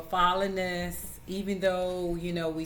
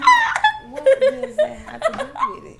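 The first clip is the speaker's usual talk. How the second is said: high, loud laughing protest; laughing high exasperation; 12 dB louder than usual. Real, not acted.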